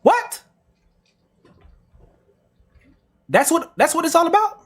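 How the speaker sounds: can't believe that's the reason; incredulous what; stunned what. Real, not acted.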